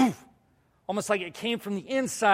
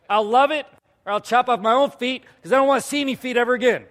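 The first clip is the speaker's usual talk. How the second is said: loud, raised-pitch comic exaggeration; mock outburst; 6 semitones higher, 8 dB louder, faster than usual. Speaker exaggerates to the congregation how foot washing could go, mock outburst. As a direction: blow the two extremes up loudly. Exaggerated two possible outcomes.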